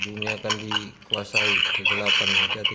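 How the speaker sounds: loud, distorted robot warning of darkness; transformer robot voice. Acted. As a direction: boom out like a movie robot. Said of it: "suara transformer itu, Optimus".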